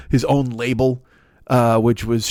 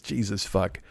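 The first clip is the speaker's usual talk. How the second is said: ordinary delivery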